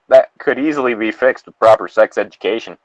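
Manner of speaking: ordinary delivery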